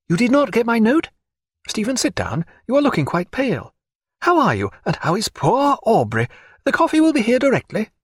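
high, fussing lady's voice; solicitous Diana. Acted. Diana asking after pale Stephen and Aubrey, high and solicitous.

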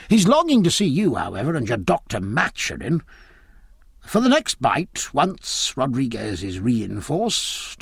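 put-on blustery old naval commander, pitch swinging; opinionated admiral. Admiral grumbles to Aubrey about politicians and foreigners, blustery. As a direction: hold forth like a self-satisfied admiral. Admiral holds forth at dinner.

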